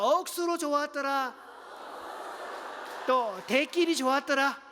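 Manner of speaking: playful Gyeongsang dialect exclamation; bright, hearty Gyeongsang-style shout of praise. Shown as a demonstration. Pastor showing the church a Gyeongsang version, lively Gyeongsang dialect. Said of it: "경상도 버전 들어갑니다".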